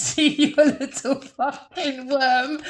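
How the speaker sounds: slow, laughing through the words; mocking him while laughing. Real, not acted. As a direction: drag it out while cracking up. Breaks up laughing mid-insult.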